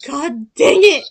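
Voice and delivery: very slow, loud groan of annoyance; hammed-up exasperation; mock frustration. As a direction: drag it out in comic defeat. Frustrated at losing credit.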